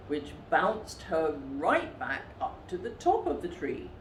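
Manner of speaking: ordinary delivery, nothing marked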